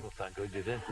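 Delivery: chuckling reply that settles into warm praise; laugh, then praise the song. Real, not acted. Interviewer to [name], laughing at his story.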